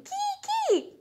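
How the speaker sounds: very high mouse squeak. Acted. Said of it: "He's saying".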